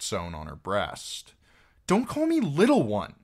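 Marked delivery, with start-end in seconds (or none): 1.9-3.1 put-on high, loud childish protest; indignant outburst. A narrator's reading. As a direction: snap back, stung at being belittled. Arya objects to being belittled.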